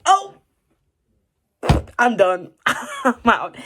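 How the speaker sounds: playful laughing surrender; laughing give-up. Retold or imitated. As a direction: laugh it off, mock defeated. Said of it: "And I was like".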